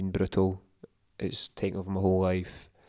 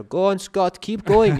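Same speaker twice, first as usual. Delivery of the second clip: much higher, loud cheer; random spectator cheering; 11 semitones higher, 8 dB louder, slower than usual. Retold or imitated. Stranger reads his name off his bib, high loud cheer. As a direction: shout encouragement at a runner. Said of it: "and you hear".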